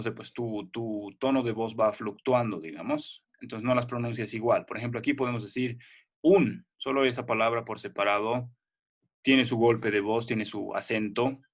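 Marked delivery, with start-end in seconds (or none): none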